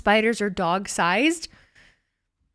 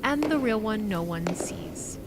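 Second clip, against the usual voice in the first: slow, weighty ominous reading; dramatic reading; 6 dB quieter, slower than usual. Acted. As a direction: lay each line down slowly like a trailer voice. Reads ominous quote dramatically.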